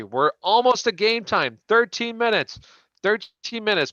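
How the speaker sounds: hyped-up heads-up to the viewers; excited countdown. Real, not acted. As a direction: build up the kickoff countdown. Counting down to game time.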